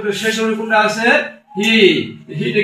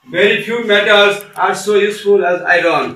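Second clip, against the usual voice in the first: each word of the sentence spaced out slowly; slow dictation; much slower than usual. Shown as a demonstration. Teacher reading the example sentence to students, very slow and clear. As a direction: read it slowly so students can write it down. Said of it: "শেষের একটি বাক্য দেখো".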